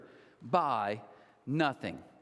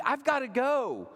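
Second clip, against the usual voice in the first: higher and slow, exasperated; frustrated; 8 semitones higher, slower than usual. Retold or imitated. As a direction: stretch it out, fed up. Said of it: "I'm like".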